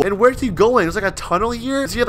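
ordinary talk, nothing marked

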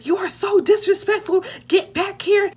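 high, loud mock scolding grandma; mimicking grandma shouting get back here; angry grandma. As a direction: yell it like an offended old lady. Grandma yelling after her.

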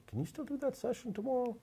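high, soft imitation of an elderly caller; retelling the sisters' call in a high, soft voice; mimicking a caller. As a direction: lift into a high, hushed voice on the phone. Sisters phoning back, acted quote.

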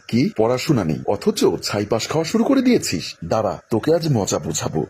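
ordinary delivery, nothing marked

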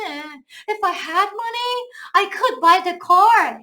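slow example reading. Shown as a demonstration. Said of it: "slow example sentence".

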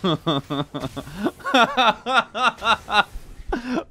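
long high laughing fit; giddy laugh. Real, not acted. Streamer laughing at his own huge tankiness, giddy laughter. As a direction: laugh in disbelief. Item makes him unkillable.

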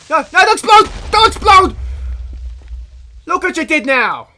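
loud high frantic yelling; panicked shouting. Real, not acted. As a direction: yell to stop the creeper. Creeper about to explode.

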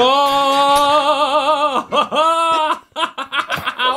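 long high-pitched laughing fit. Real, not acted. Gamer laughing amid the witch attack, long laugh. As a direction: burst into drawn-out laughter.

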